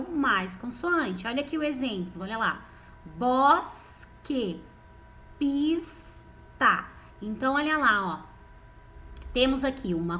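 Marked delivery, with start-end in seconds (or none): 3.2-4.6 "Bosque" split as bos, pause, que; syllable by syllable. Shown as a demonstration. Teacher giving pupils an example, "Bosque" broken into syllables. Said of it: "o som de quando eu falo bos". 5.4-6.8 "pista" split as pis with a long S, pause, ta; syllable by syllable. Shown as a demonstration. Teacher giving pupils an example, "pista" broken into two syllables. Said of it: "saiu junto aqui no mesmo som".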